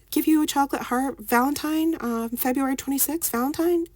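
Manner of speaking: high-pitched impression of a schoolboy's offer; imitating an eager student. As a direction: mimic the boy in a very high voice. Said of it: "he was like".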